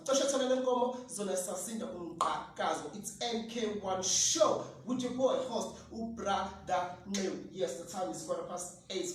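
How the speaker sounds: ordinary talk, nothing marked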